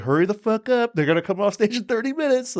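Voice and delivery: high, pressing hurry-up; high anxious urging; urgent pushing. Retold or imitated. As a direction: rush the crew before the show ends. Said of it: "I'm like".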